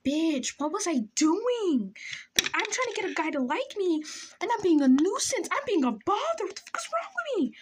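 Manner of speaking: high-pitched hammed-up whine; exasperated self-scolding. Teller scolding her younger self over crush, exasperated wail. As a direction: berate yourself in a rising wail.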